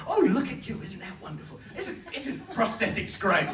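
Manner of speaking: high, laughing gush, slowing at the end; gushing admiration. Retold or imitated. Actor admiring an extra's stump, gushing high and laughing. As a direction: gush over the fake leg like it's marvellous. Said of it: "I come up and go".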